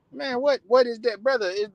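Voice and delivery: ordinary talk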